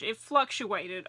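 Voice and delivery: ordinary talk, nothing marked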